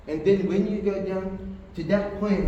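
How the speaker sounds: usual speaking voice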